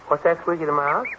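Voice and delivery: ordinary talk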